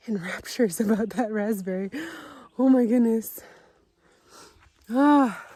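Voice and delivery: loud and high, gushing over the plants; excited gushing. Real, not acted. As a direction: gush with delight, nearly squealing. Gushing over her bee balm.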